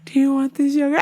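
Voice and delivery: high-pitched mock-mom question, rising; coaxing mother imitation. Mother offering her child yogurt, high mock coaxing.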